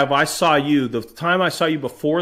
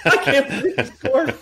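high, laughing mock disbelief; high and giggling at the surprise goal; 10 semitones higher than usual. Retelling own reaction to goal.

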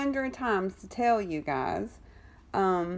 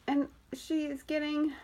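out of breath; 8 semitones higher than usual. Real, not acted. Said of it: "I am out of breath".

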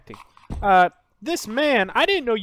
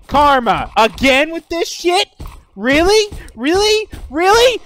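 higher, louder, rising disbelief on each Really; exasperated angry shout; 9 semitones higher, 6 dB louder than usual. Acted. Furious at players ganging up.

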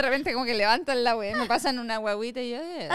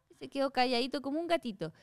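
normal talking voice

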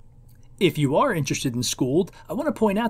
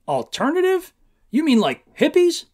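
incredulous cop, pitched much higher; astonished police officer; 11 semitones higher, 6 dB louder than usual. Acted. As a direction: gape at the boy in disbelief. Officer stunned by commune answer.

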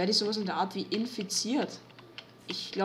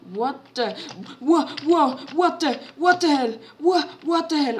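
high loud shocked outburst; 5 semitones higher, 8 dB louder than usual. Real, not acted. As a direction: yell it out, totally shocked. Shocked by mob.

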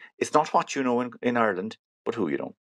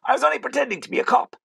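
mock confession; 4 dB louder than usual. Fake cop confesses to driver.

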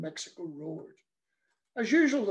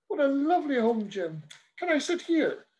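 higher, louder cheery priest; chirpy priest; 7 semitones higher, 3 dB louder than usual. Acted. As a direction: breeze in all friendly and pleased. Priest voiced in story.

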